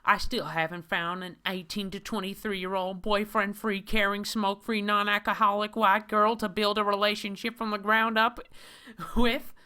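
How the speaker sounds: high, loud mocking nerdy whine; hammed-up high whiny man voice. Reads the comic hero's own words.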